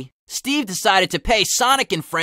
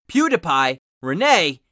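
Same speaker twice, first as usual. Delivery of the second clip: plain reading voice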